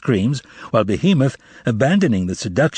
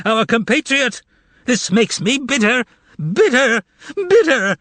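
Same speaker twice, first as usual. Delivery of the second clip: wailing bellow; 11 semitones higher than usual. Acted. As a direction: wail it like a wedding best man. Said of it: "bellowed at the top of his lungs; wailed".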